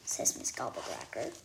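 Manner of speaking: ordinary delivery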